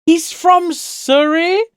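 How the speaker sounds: shocked high-pitched rising question; surprised. Real, not acted. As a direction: squeal the question in disbelief. Reacting to chat.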